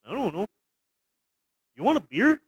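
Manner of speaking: put-on a light, friendly Canadian accent with a tacked-on eh; lightly voiced cheerful Canadian neighbour; mock Canadian accent. Imitating a polite Canadian Nazi for his co-host, in a light Canadian accent. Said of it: "that Canadian sort of attitude of, like".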